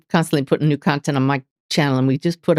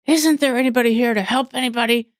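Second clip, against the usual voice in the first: raised-pitch mock demand of a rude customer; higher, mildly indignant customer voice; retold loud complaint; 6 semitones higher than usual. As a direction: pitch it up like an impatient man calling across a store, without shouting. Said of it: "really loud, he said".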